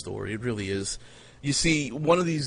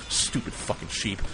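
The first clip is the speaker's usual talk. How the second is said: unvoiced, muttered under the breath; muttered insult; 3 semitones lower, 6 dB quieter than usual. Real, not acted. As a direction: spit it out under your breath.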